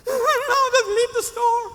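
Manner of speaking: high, loud, tearful voice breaking; crying plea. Retold or imitated. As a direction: beg through sobs to be left alone. Crying, sends assistant away.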